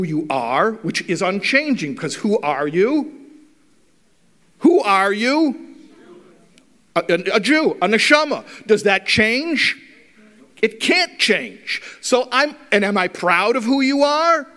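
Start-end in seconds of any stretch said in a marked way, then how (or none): 4.6-5.7 slow, louder, every word weighted; emphatic question. Real, not acted. Rabbi pressing the audience, slow and emphatic. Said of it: "preacher's emphatic question". 8.7-11.8 higher, louder, slowly hammered out; insistent and slow. Real, not acted. Rabbi insisting to the audience, slow and firm. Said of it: "preacher driving point home".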